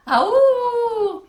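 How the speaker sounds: long high eerie howl; spine-tingling howl. A narrator's reading. Camp director howls at the campers, spine-tingling. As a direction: howl like a creature in the dark. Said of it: "let out the most spine-tingling".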